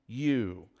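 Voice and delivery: YOU dropped low and soft; stress on you. Shown as a demonstration. Pastor asking the church, stress on you. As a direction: lean on the word you. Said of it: "Did he emphasize".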